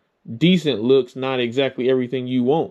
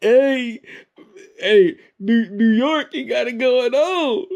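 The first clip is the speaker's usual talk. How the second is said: laughing mockery; 14 semitones higher than usual. Real, not acted. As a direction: crack up while teasing in his slang. Host mocks the friend's story.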